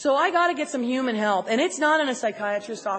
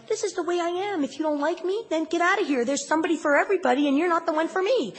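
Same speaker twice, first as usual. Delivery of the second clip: higher, brusque inner voice; mock defiance, pitched up; defiant self-justifying thought; 5 semitones higher, faster than usual. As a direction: voice a stubborn "take me or leave me" thought. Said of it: "gonna think something like".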